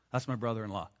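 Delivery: a quiet smug muttered aside. Retold or imitated. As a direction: churchgoer pointing at a relative, muttered. Imitating smug churchgoer.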